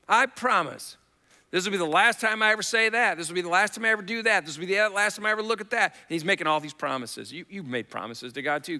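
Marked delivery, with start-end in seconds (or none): 0.1-1.0 louder higher promise. Retold or imitated. Same words, raised. 1.5-5.9 escalating vow. Retold or imitated. Mock vows, rising pitch.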